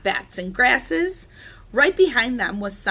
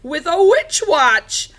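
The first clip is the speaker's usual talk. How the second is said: loud, slow, high witch; 7 semitones higher, 9 dB louder, slower than usual. A narrator's reading. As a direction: answer as a hammed-up witch. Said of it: "the witch answered".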